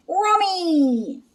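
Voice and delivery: a dog trying to say yummy; Scooby voice. Acted. Scooby finds food.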